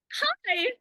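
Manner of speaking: high hi. Retold or imitated. Acted greeting to Lauko.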